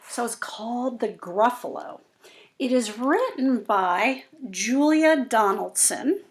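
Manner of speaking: usual speaking voice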